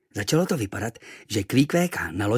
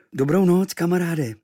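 usual speaking voice

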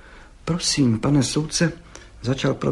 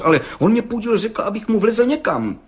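much higher, louder protest; stammering young man; 12 semitones higher, 5 dB louder than usual. A narrator's reading. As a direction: blurt out a flustered complaint. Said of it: "koktal mladý cihlář". (rozrušeně, vysokým hlasem)

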